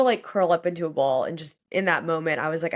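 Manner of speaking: usual speaking voice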